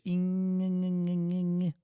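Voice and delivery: ing with wide cheeks and a frozen mouth; ing sound. Shown as a demonstration. Teacher holding the ing sound for the class. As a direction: hum ing with the mouth held still. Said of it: "Widen your cheeks here and freeze your mouth".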